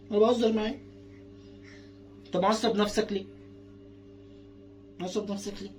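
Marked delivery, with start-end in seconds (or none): 5.0-5.7 same question dropped to a soft murmur; hushed, gentle echo of the question; much softer repeat. Shown as a demonstration. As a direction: soften it right down to the toddler. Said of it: "repeated much softer".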